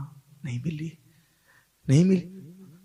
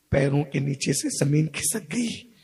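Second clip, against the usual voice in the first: ordinary talk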